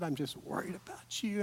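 soft whispered concern; whispered worry. Retold or imitated. Crying wife retold.

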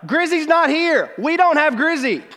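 mom yelling high and loud down the phone; retold yelling. Mom yelling on the phone that the dog is missing, loud and high. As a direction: shout it like a frantic mom on the line. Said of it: "I hear her just yelling on the line".

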